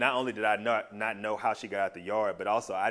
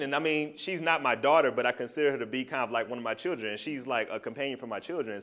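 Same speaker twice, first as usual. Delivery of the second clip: normal talking voice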